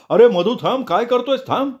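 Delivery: high-pitched, alarmed yell; loud shout. A narrator's reading. Mukunda shouting at Madhu to stop, loud and high. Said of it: "Mukunda जोरात ओरडला".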